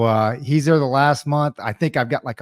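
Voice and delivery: ordinary talk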